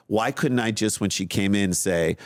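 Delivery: normal talking voice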